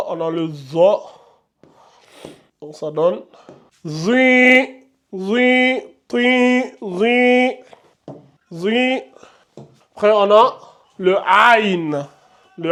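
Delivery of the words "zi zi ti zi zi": zi and ti each held long, pitched higher; long i vowels. Shown as a demonstration. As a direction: chant the long i syllables clearly. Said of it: "vous allongez de deux secondes".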